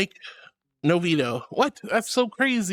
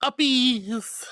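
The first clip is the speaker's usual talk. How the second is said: high, drawn-out sing-out; playful uppies call; 5 semitones higher than usual. Pretend. Streamer lifts up viewers who asked, playful high call. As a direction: sing out the word, stretching the end.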